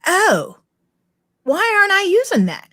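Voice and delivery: high, dawning surprise; like a lightbulb just went on; sudden realization. Retold or imitated. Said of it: "And I'm like".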